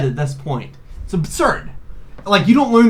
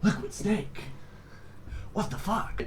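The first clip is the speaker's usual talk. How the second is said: gravelly Snake impression; 5 semitones higher, 15 dB quieter than usual. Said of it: "Solid Snake gets up and he says".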